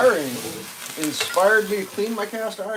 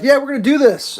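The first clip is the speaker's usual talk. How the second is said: mock fraudster; 9 semitones higher, 8 dB louder than usual. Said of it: "they just were like".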